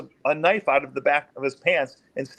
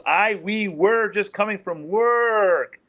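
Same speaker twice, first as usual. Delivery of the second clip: higher imitation, drawn out at the end; changed voice; 4 semitones higher, much slower than usual. Suspect trying to convince officer, imitated higher voice. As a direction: lift the pitch and stretch the last word. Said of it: "And his voice changes".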